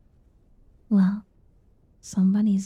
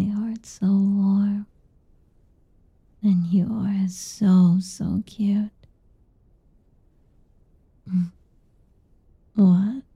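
ASMR whisper. Acted. Mother whispers to her boy at bedtime, intimate ASMR whisper. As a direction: whisper tender nothings to lull him.

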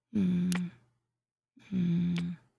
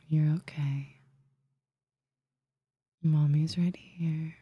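soothing whisper. Acted. Calming the listener, shushing.

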